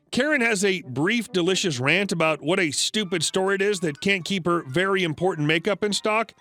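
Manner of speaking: ordinary delivery, nothing marked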